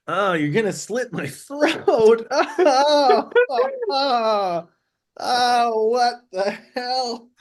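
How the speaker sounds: high, drawn-out mock wailing; loud, pleading dismay with stretched vowels; panicked wail. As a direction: wail in hammed-up despair, way up high. Character wails at threat to slit throat.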